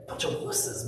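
whispered aside, dismissive; muttered insult. Retold or imitated. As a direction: whisper it under your breath. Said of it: "I'm like".

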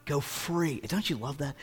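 normal talking voice

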